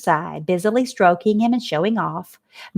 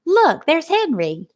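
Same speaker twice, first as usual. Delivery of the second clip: excited cry; 8 semitones higher, slower than usual. A narrator's reading. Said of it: "cried Fern".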